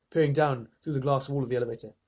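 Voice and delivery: ordinary delivery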